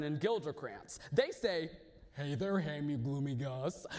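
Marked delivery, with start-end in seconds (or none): 2.1-3.9 lower, casual ribbing; a lightly voiced buddy teasing; teasing friends. Friends tease Hamlet.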